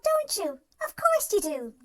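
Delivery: usual speaking voice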